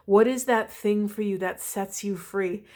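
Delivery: usual speaking voice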